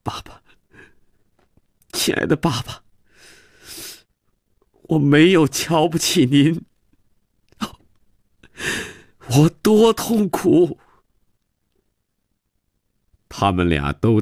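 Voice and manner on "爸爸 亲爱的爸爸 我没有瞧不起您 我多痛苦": put-on tearful high-pitched child's cry. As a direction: rise from a faint sob to a high wail. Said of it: "哭着叫道". (哭着喊爸爸)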